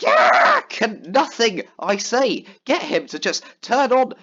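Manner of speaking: loud, high exasperated yell; frustrated shout. Retold or imitated. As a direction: burst out, fed up with him. Retelling angry outburst at friend.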